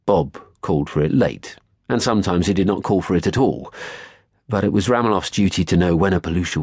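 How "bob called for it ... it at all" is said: plain reading voice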